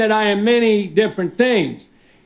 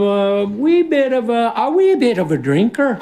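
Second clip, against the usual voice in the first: put-on comic Irish accent on a false start and restart; 2 semitones higher than usual. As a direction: slip into a playful Irish lilt. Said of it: "I don't know why I went Irish there".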